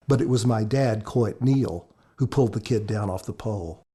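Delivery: ordinary delivery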